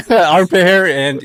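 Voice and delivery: can barely get it out for laughing. Real, not acted. Laughing fit about armpit hair.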